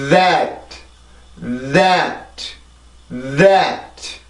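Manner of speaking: "That" with a long buzzing voiced th; "That" with vibrating tongue, stressed vowel; English th demo. Shown as a demonstration. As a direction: let air and voice build on th before "at". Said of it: "자, 발음해볼게요".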